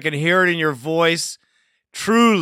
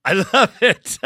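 cracking up with approval; delighted laughing; 7 semitones higher than usual. Real, not acted. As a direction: laugh through it, loving the caller's rant. Host cracks up at caller.

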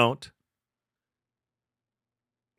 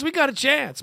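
hopeful; 9 semitones higher than usual. Real, not acted. Hopeful repeat of a phrase.

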